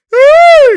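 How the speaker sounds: a sudden shrieking laugh at a bad play; screaming laugh. Real, not acted. Furious fan shrieks with laughter at the TV. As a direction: let out a shrieking cackle.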